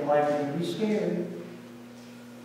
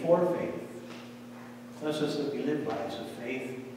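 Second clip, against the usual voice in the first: reverent prayer voice that sinks softer toward amen; closing prayer; 3 semitones lower, 4 dB quieter than usual. Acted. Pastor closing the children's sermon with a prayer, reverent and soft. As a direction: pray quietly over the children.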